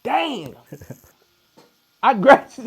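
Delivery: high surprised laugh. Real, not acted. Guest learns the host's birth year, surprised and laughing. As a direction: caught off guard by the age gap.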